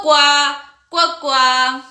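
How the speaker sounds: cuac de pato, más fuerte; pato haciendo cuac. Shown as a demonstration. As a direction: graznido alegre para niños. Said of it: "A él le gusta decir cuac". (graznido de pato imitado)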